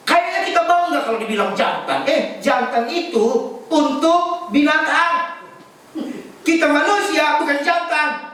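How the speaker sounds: high, ringing preacher's voice; insistent sermon delivery, pitched well up; emphatic preaching. Real, not acted. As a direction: exhort the congregation at a high pitch.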